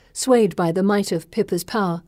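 ordinary delivery, nothing marked

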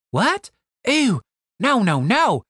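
high-pitched, disgusted protest; recoil, pitching right up in disbelief; taken aback. Acted. Stacy recoiling at Julian calling her Pumpkin, taken aback.

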